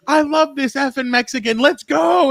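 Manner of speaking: high, excited British pub fan. Retold or imitated. Pub fan cheering the Mexican guest, high and excited. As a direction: cheer it like a thrilled stranger in a pub. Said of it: "And he gets all excited".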